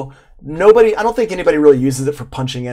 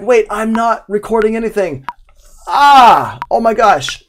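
higher, louder alarmed realization; sudden panic; 8 semitones higher, 6 dB louder than usual. Pretend. Engineer spotting the unrecorded take, alarmed. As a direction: gasp it out as the take slips away.

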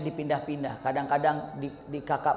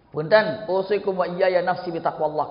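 ordinary delivery

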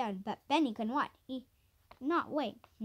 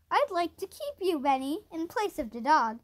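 usual speaking voice

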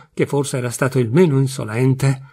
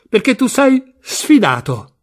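dramatic announcement; 7 semitones higher, 4 dB louder, slower than usual. Acted. Wife announces the challenge.

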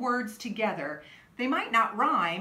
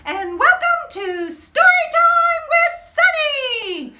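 excited greeting; 11 dB louder than usual. Real, not acted. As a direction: call out a cheery welcome, loud and high. Kids show host greeting.

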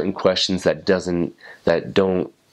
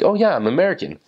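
chirpy, much higher answer; cheery reply; 10 semitones higher, faster than usual. Retold or imitated. Missionary answering a French local, brightly upbeat. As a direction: toss it off brightly, almost sing-song.

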